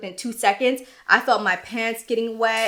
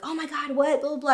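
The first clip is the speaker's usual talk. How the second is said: shocked friends; 7 semitones higher than usual. Retold or imitated. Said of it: "They're like".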